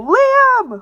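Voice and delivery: high loud call across the room; shouting a name. Acted. Friend bursting in, calling out loud. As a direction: yell his name to get attention.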